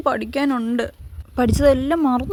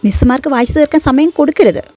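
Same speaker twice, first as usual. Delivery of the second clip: louder, boastful reassurance to the gang; loud pep talk; 8 dB louder than usual. Acted. Student hyping up classmates before the exam, loud and boastful. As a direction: pump up your nervous friends, loudly.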